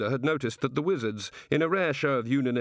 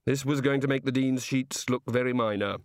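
ordinary delivery, nothing marked

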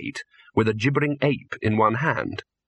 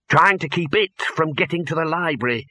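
the Dean; 5 semitones higher than usual. Acted. Dean's voice.

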